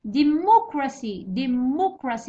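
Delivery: Democracy slowly, with 'mo' punched; stress on MO. Shown as a demonstration. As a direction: hit and stretch 'mo' in Democracy. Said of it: "والثالث هو مو".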